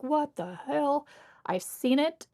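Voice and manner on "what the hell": shocked. Retold or imitated. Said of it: "you're like".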